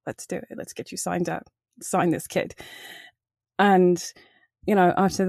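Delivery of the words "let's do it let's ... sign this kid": soft, lowered aside, imitating the director; confidencial. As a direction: confide it quietly, as if pulling someone aside. Acts out director pulling her aside.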